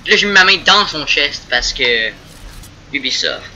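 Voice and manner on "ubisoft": ordinary delivery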